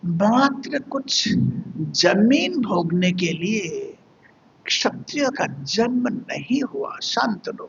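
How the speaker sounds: usual speaking voice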